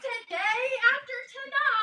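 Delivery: upset friend starting to break down; near tears. Acted. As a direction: voice cracking, on the edge of crying.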